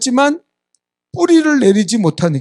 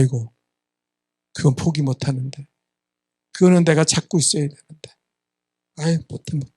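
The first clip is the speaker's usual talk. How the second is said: low, softened mock reluctance; reluctant refusal; 6 semitones lower, 5 dB quieter than usual. Rich young man told to give up wealth, mock reluctant. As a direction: imitate someone backing away quietly from a hard demand.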